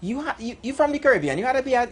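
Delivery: quoted food server. Retold or imitated. Servers guess the customer is Caribbean, high mimicked voice.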